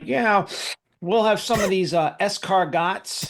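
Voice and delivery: high-pitched bumbling diner mangling French; Mister Furley. Retold or imitated. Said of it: "it's like Mister Furley going to the French restaurant".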